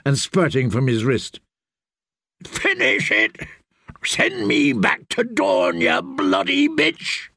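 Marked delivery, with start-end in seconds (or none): 2.4-7.3 high, slow, agonised strain; dying plea. A narrator's reading. Mortally wounded Dornishman to his killer, agonised demand. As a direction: force the words out as you die.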